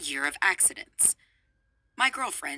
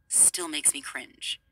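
whispered; 4 semitones lower, 5 dB quieter, slower than usual. Real, not acted. Cringing at a word.

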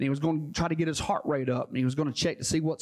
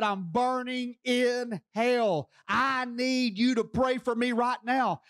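slow, pleading cry of a man in torment; a dying man's slow, terrified plea to his doctor; mock terrified scream; 4 semitones higher, slower than usual. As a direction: beg the doctor, desperate but not yelling. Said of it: "blood-hurling scream and said".

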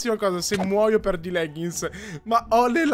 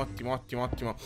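muttered; 10 semitones lower, 14 dB quieter, much faster than usual. Real, not acted. Muttered satisfaction.

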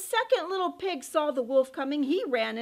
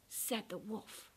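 ordinary delivery, nothing marked

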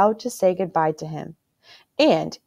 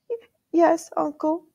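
stammered, much higher, tearful; a child choking on tears; sobbing; 11 semitones higher than usual. A narrator's reading. Boy sobbing his answer to his uncle, tearful. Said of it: "sobbed Caspian".

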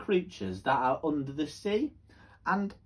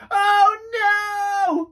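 high, loud frightened cry; alarmed cry; 12 dB louder than usual. Acted. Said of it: "cried the fish".

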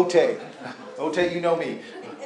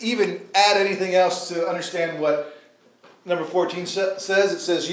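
usual speaking voice

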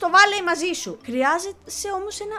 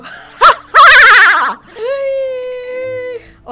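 loud, high laughing scream; cackle, then hold a high squeal; shrieking laugh into a long whine; wild cackling shriek; 8 semitones higher, 14 dB louder than usual. Real, not acted. Fishing minigame goes wrong.

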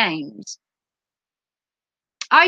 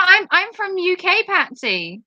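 ordinary talk

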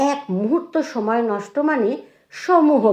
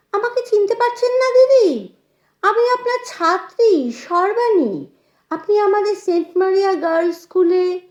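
high, eager greeting; delighted recognition; 9 semitones higher, 3 dB louder than usual. A narrator's reading. Former pupil to her old teacher at the door, delighted. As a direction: gush with pitch held well up.

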